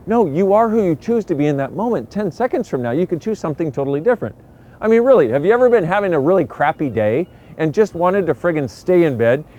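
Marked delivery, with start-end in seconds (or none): none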